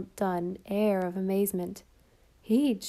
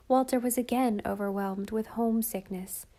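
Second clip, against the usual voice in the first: plain reading voice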